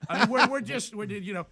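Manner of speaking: words breaking into laughter; laughing. Real, not acted. Retelling the standoff with the guard, laughing loudly.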